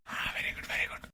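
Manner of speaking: barely audible pleased whisper; very soft, hushed aside; whispered approval. Acted. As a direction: murmur praise secretly under the breath. Covert approval to the doctor.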